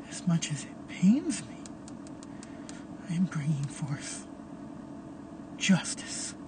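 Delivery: cold, unvoiced whisper of judgement; menacing whisper. Caleb sentencing Tara before killing her, a menacing whisper. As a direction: hiss the verdict under your breath.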